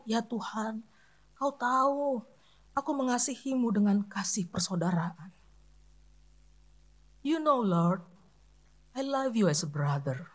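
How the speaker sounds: soft, low, heartbroken confession; whisper-close, from a broken heart; low humble voice. Retold or imitated. Preacher voicing Peter answering Jesus in a low, humble voice. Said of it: "dengan suara yang rendah".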